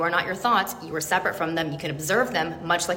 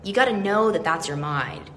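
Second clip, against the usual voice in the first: ordinary delivery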